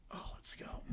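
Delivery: soft unvoiced whisper. Real, not acted. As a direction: breathe it under your breath. Whispered aside.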